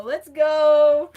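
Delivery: loud hype cheer; excited shout. Real, not acted. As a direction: yell it out with joy.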